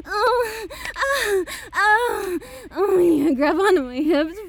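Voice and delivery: breathless, moaning throughout; gasping lover lost in the moment; moaning arousal. Acted. Lover moaning to Daddy during sex, breathless.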